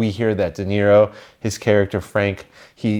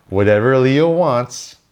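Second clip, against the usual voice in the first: quoting Leo Getz; slower than usual. Retold or imitated. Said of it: "Leo Getz. You remember that?".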